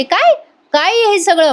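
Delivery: high, shocked "काय?" then a stunned question; mock disbelief, voice jumping up; a mother's startled outburst; shocked gasp. Mother learns the secret plan.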